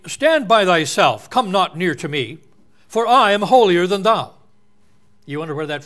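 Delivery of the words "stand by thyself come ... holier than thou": put-on proud, lofty English accent, louder; mock holier-than-thou English accent. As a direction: sneer it down in a haughty English accent. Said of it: "Sort of an English accent".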